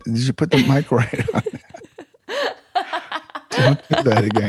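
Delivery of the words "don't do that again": laughing. Real, not acted. Laughing after loud bowl strike.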